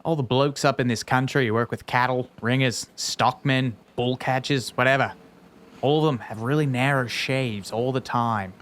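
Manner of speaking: reading in a put-on Australian accent; broad Australian bloke reading the quote; lightly done Australian accent, slipping in places. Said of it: "can you do an Australian accent".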